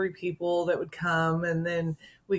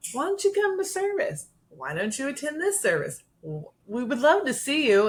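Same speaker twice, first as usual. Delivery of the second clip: inviting church folk; 7 semitones higher than usual. Retold or imitated. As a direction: sing it out like an eager church greeter. People inviting her to service.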